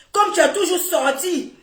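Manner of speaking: ordinary delivery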